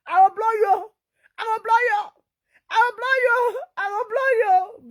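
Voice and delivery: high, loud playful threat, hammered four times; mock threat. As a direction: shout it up high like a teasing fighter.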